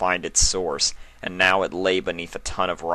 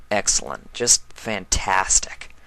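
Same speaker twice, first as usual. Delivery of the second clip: sarcastic. Acted. Sarcasm after failing to find lever.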